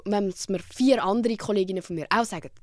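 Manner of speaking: ordinary delivery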